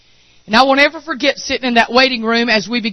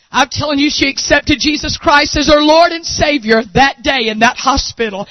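normal talking voice

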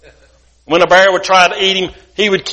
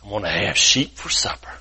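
low, softer wolf voice; 10 semitones lower, 6 dB quieter than usual. Acted. Wolf who smells Sheppy, low and menacing. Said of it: "He says".

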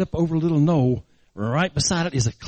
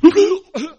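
high, loud acted gasping splutter; surfacing gasp; 13 semitones higher, 7 dB louder than usual. Boy jerked out of the water, gasping. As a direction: heave for air after near drowning. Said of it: "and he goes".

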